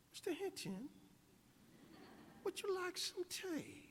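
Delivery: high, soft British queen; British accent. Acted. The Queen offering tea to her guest, mock British accent. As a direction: put on a dainty, high-pitched British accent. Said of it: "in her British accent".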